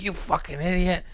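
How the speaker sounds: high-pitched imitation of anger; so mad. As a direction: curse like a furious old man. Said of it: "he was so mad at me".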